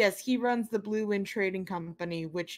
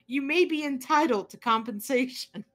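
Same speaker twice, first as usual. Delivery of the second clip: higher, louder commercial announcer read; injury lawyer ad; 5 semitones higher, 3 dB louder than usual. Pretend. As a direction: sell it like a legal ad. Continues the ad parody.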